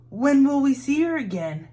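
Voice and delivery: put-on a small boy asking hopefully; Bob asking. A narrator's reading. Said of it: "asked Bob".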